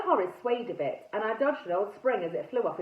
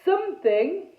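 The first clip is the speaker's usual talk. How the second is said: loud, raised knightly proclamation; booming loudly; 7 semitones higher, 6 dB louder, slower than usual. A narrator's reading. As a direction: boom it so the whole house hears. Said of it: "he boomed so loud".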